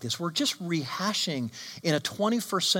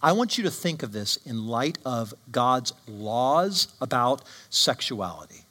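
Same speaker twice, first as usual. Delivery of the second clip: ordinary talk, nothing marked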